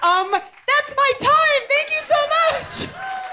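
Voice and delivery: high, slow and excited; relieved after the set. Real, not acted. Finishing her first set.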